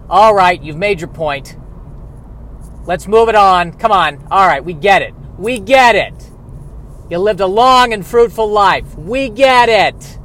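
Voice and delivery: loud, high, impatient outburst; exasperated. Acted. Mourners fed up with an old relative, exasperated. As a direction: build to a fed-up shout, stretching the last words.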